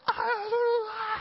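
high garbled scream; mock panicked wail. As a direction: burst into a hammed-up wail of panic. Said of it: "he went from, like".

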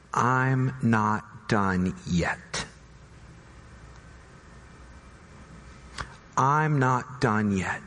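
slow, low reassurance; slow and tender. Acted. Jesus tells the frightened believer, slow and reassuring. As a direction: speak it slowly, like a promise.

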